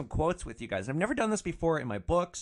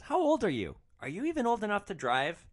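high-pitched doubting question; incredulous questioning; 6 semitones higher than usual. Retold or imitated. Passenger questions the young driver's age, incredulous. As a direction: question it in mock disbelief.

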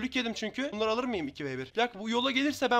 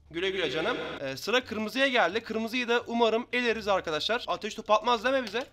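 ordinary delivery

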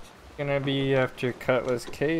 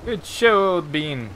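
higher, louder jolly toff voice; bellowing stage Englishman, higher and louder; hammed-up British gent; 6 semitones higher, 8 dB louder, slower than usual. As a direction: cheer them on like a pompous English gentleman. Mock British gent praise.

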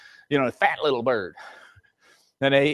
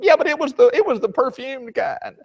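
put-on much higher child's voice, pleading; whiny kid; 9 semitones higher than usual. Child argues with dad about the perfumed soap, high. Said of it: "they'd say".

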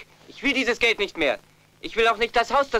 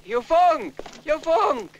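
raised voice calling a name; 6 semitones higher, slower than usual. Acted. Brother calls out happily to Yufeng, raised voice. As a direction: call him from across the room.

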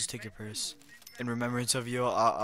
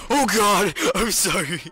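loud, high, strained apology; mock horrified; 9 semitones higher, 12 dB louder than usual. Player apologising to his victim, mock horrified. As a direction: cry out in hammed-up remorse. Apologising after killing in game.

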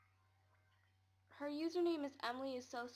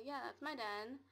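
normal talking voice

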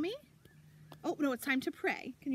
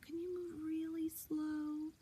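slow like a snail; 8 dB quieter, slower than usual. Shown as a demonstration. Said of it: "move slow like a snail".

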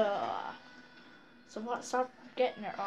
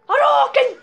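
shouted battle cry; 12 semitones higher, 11 dB louder than usual. Pretend. Player calls out a game move, high mock battle shout. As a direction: yell it like a fighting-game special move.

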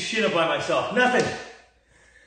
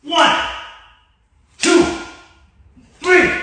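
counting workout reps; 10 semitones higher, 7 dB louder, slower than usual. Real, not acted. Shouting workout rep count.

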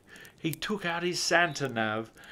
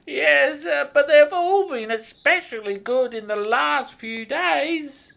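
high, loud hesitant elf voice; little old elf; 14 semitones higher, 8 dB louder than usual. A narrator's reading. Elf hedging his answer to Santa, high and hesitant. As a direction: squeak it out nervously as the elf.

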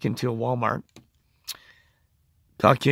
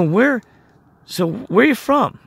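higher, louder upbeat re-enacted question; chatty questioner; 9 semitones higher, 5 dB louder, slower than usual. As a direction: ask it brightly, as if making small talk. Re-enacting his friendly questioning.

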